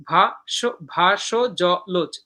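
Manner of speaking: ভা, সো, জ, লোচ counted out in separate beats; short gap after each of ভা, সো, জ, লোচ; syllable by syllable. Shown as a demonstration. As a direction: slowly split ভা, সো, জ, লোচ. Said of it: "এখানে একটা পর্ব হলো".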